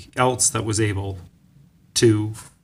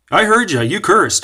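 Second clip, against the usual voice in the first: raised, loud imitation of an angry coach; angry accusation; 9 semitones higher, 7 dB louder than usual. Coach accuses gymnast of cursing, angry. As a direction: accuse them, pitched high and loud. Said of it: "he sounded pretty angry".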